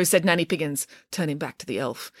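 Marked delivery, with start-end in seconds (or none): none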